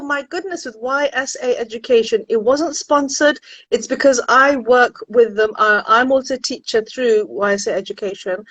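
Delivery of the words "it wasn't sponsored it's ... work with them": ordinary talk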